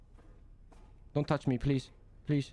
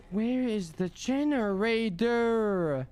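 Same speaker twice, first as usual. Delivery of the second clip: slow, high drawn-out whine; exasperated; 8 semitones higher, much slower than usual. Real, not acted. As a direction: stretch every word out in disbelief. Can't find generator.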